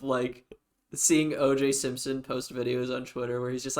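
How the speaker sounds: normal talking voice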